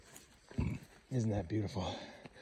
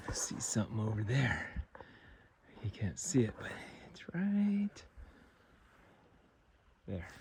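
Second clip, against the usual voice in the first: whispering; 9 dB quieter than usual. Real, not acted. Spotting something while hunting.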